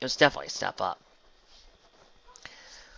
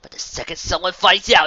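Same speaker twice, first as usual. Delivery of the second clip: bitter accusation; 6 semitones higher, 10 dB louder than usual. A narrator's reading. Lovers' quarrel, bitter accusation.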